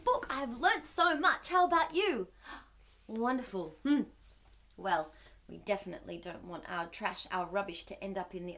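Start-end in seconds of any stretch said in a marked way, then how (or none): none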